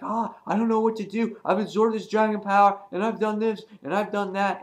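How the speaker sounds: high, hurried, flustered babble; acted-out frantic, high-pitched rambling; mock panic. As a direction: play a girl fretting in a rush. Acts out Hope fleeing, overwhelmed.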